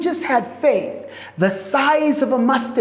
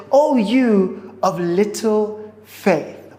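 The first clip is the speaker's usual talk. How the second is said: slow gentle rebuke; slower than usual. Acted. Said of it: "He says".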